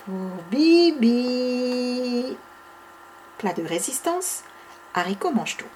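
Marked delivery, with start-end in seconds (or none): 0.1-2.4 slow drawn-out. Acted. Answer drawn out.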